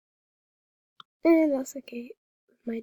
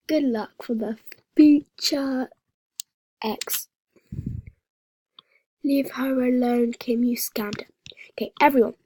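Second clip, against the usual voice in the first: ordinary talk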